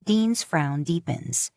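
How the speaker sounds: ordinary delivery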